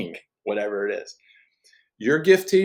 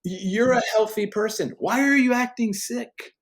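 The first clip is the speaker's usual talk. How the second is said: much higher, gently challenging God voice; voicing God; 9 semitones higher than usual. God asking a believer why he acts sick, high and gentle. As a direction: ask it as a loving father puzzled by his child. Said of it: "that's how he talks".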